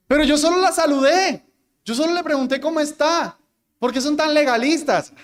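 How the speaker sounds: mock-aggrieved objector, pitched high; imitating someone whining in self-defence; indignant protest.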